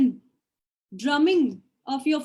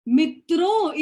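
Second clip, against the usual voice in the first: loud Modi impression; 4 semitones higher, 6 dB louder than usual. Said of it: "is so loud".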